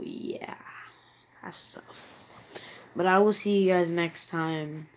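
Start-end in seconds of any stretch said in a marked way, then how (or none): 0.0-2.2 whispered letdown; breathy whisper of disappointment. Real, not acted. Disappointed pack opening sign-off.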